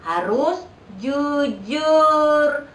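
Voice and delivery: "jujur" stretched very slowly, every syllable held; drawn-out emphasis. Real, not acted. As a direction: lean on "harus jujur", drawing each word out. Teacher stresses honesty to kids. ("harus jujur" diucapkan sangat pelan dan ditekan)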